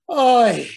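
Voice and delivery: a loud, drawn-out groan of oy. Retold or imitated. Enslaved Israelites groan oy instead of praying, loud groan. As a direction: let out a weary oy. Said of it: "They just say".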